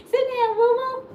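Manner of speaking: high, playful order to a little one. Real, not acted. Telling her child to sit.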